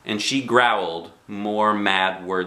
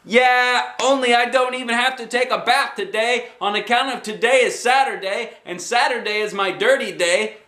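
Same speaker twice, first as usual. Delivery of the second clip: hollered back; 14 semitones higher than usual. A narrator's reading. Junie B hollering back to Mother about bath day. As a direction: yell the excuse through the house. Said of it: "I hollered back".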